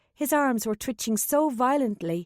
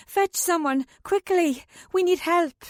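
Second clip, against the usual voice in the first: higher, pressing call for help; urgent plea; 7 semitones higher, 3 dB louder than usual. A narrator's reading. Katrin begs for help, urgent and raised. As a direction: urge them to fetch help fast.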